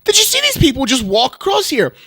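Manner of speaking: loud, high, incredulous complaint to Katie; outraged question. Retold or imitated. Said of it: "I'm like".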